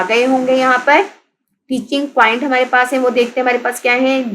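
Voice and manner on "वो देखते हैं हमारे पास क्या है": normal talking voice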